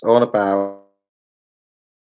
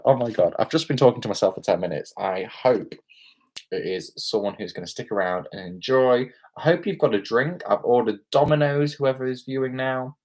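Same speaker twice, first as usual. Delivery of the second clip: normal talking voice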